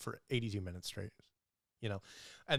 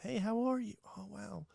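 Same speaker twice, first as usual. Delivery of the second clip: high, sugary, fawning voice; catering sweetly to the wife in a high voice; 10 semitones higher than usual. Retold or imitated. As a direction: gush over her like a charming host. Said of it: "talked to them like".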